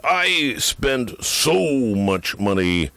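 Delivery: slow, drawn-out radio pitchman; mock ad read. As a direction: parody radio endorsement. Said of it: "it's sort of like this".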